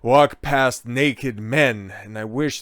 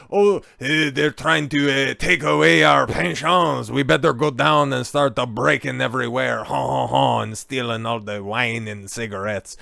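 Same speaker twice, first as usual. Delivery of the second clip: mock French accent; 2 semitones higher, 5 dB louder than usual. Mocking French protesters in a cartoon French accent. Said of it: "to do a French accent".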